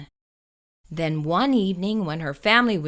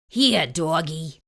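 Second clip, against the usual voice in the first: slow, lower coaxing burglar; 4 semitones lower than usual. Acted. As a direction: coax the dog sweetly. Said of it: "he said".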